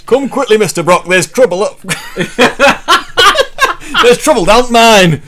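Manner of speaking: broad northern English voice cracking with laughter; northern miner accent. Acted. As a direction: ham up a northern mining-town shout. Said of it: "Northern Spider-Man".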